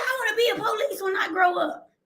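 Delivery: put-on high, quick little-girl voice; child voice. Sister at five telling her dream, high quick child voice. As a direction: lightly voice a five-year-old sister. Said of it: "She was like".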